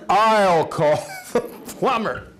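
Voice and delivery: slow, high, loud-edged wife impression; hammed-up insistent wife; wife's mock insistence. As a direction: take charge emphatically, drawing it out. Wife taking over, acted after disaster.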